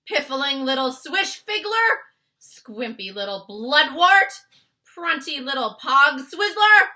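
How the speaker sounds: huge high bellow, slow; shouting. Acted. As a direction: roar the insult. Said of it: "he shouted".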